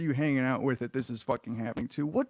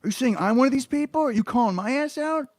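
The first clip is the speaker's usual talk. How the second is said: affronted, as if someone just called you out; indignant outburst; 8 semitones higher, 5 dB louder than usual. Real, not acted. Outraged at being called obsessed.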